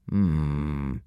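low thinking hmm; thoughtful hum. A narrator's reading. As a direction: hum while you consider. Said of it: "said Gobber, thoughtfully".

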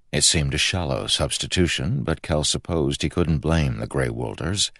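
plain reading voice

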